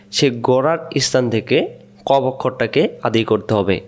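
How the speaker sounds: ordinary delivery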